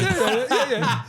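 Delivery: high slow laugh; laughing yeahs. Real, not acted. Laughing at the story.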